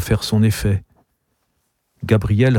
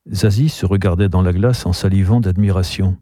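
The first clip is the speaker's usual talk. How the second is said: usual speaking voice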